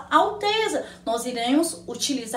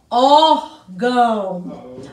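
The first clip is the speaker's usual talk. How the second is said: "órgão" drawn out very slowly, lower; slow model word; 5 semitones lower, 3 dB louder, much slower than usual. Shown as a demonstration. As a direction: stretch out "órgão" for the class to copy. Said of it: "teacher models the word". ("órgão" dito muito devagar, mais grave)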